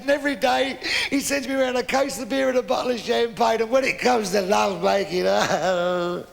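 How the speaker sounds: high, wailing mock sobs; crying old man. Old man listing his young wife's kindnesses, comically weeping. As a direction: bawl through the good news like a heartbroken old man. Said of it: "What are you crying for".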